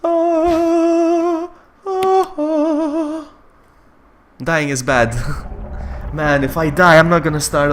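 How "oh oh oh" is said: long high quavering "oh"; wavering wail. Real, not acted. Player reacting to near death, high wail. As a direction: hold high notes with a wobble. Wailing after game scare.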